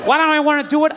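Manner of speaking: ordinary talk, nothing marked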